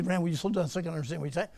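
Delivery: gabbled fast, words running together; rushed speech. Retold or imitated. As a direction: rattle it off at speed. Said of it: "What do you say".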